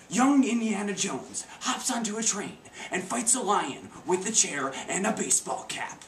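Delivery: lightly mocked slow movie narrator; a touch of slow, deliberate narration; audio description narrator. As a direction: describe the film for blind viewers, slowed down. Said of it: "for the visually impaired".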